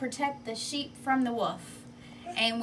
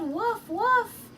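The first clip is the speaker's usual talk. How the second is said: high, louder retold yell; mock yell; 11 semitones higher, 4 dB louder than usual. Shepherd boy retelling his call to the town, mock yell. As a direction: replay the yell in a higher voice. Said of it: "I yelled".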